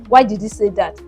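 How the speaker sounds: plain reading voice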